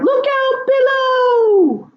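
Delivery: high, slow cry of look out below; cried out. Acted. Mother acting the ninja's cry in the limerick, high and drawn out. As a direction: yell the warning like a falling ninja. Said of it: "So he simply cried".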